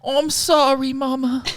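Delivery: put-on high, small British child voice; a sorry little child hugging mother. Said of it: "the woman voice I thought I could do on the child".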